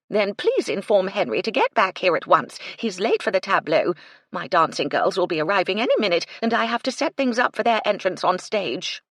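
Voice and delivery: snapping. Acted. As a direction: hurry her impatiently. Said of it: "Phoebe snapped".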